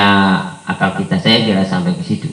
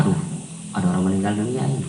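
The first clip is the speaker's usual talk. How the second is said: casual, offhand; 3 semitones lower, 11 dB quieter, much faster than usual. Shown as a demonstration. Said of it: "Coba biasa saja kayaknya".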